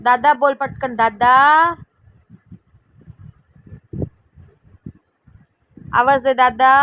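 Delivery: loud call across the house. Real, not acted. Mother calling the older brother from where she sits, loud call. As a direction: call big brother to come.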